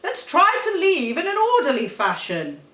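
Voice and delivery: loud strained command voice; passionate bark. Acted. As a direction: shout to keep the crowd orderly. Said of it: "barked Clive in a great fit of passion".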